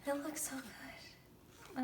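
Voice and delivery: slow, soft, unvoiced whisper; breathless whisper. Real, not acted. Fan whispering to viewers about the photo, slow whisper. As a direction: breathe it out, awestruck.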